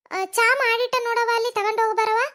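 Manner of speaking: a mother calls her daughter in to take the tea; calling out from the house. Acted. Mother calls Kavya from another room to fetch the tea, loud call.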